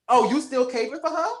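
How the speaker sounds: high teasing side-eye question; sassy jab. Retold or imitated. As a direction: needle him about his ex. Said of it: "she was like".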